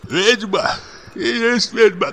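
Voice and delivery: high, tearful cry of "Ведьма". A narrator's reading. Husband cries at his wife, near tears. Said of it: "плачущим голосом".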